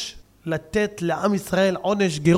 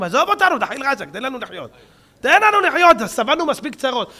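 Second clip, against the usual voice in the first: pleading cry; 6 semitones higher, 6 dB louder than usual. Retold or imitated. People begging the preacher stop.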